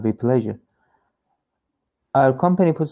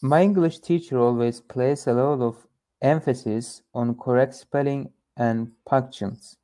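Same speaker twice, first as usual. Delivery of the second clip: ordinary delivery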